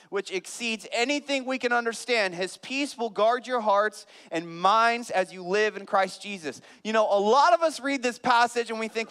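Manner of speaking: loud, high preaching build; full-force preaching. Acted. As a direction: preach it out to the congregation, rising.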